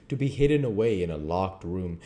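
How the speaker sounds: ordinary delivery, nothing marked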